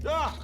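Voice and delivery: short high yelp of fright; frightened yelp. Acted. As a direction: yelp at a dizzying drop. I just looked down.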